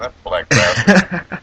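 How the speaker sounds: slow mock English accent with a laugh in it. Waiter imagined cursing the host, slow laughing English accent. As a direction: drawl it out in a jokey English accent. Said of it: "in an English accent".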